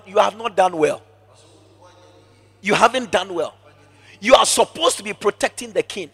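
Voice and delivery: ordinary talk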